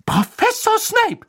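very high, loud indignant shriek; shrieking nurse. A narrator's reading. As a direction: shriek his name to stop him. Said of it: "kreischte Madame Pomfrey".

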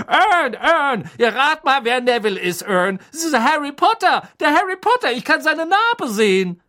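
high, loud excited calling; gloating shout. A narrator's reading. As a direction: crow the big news to a mate. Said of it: "rief Stan schadenfroh".

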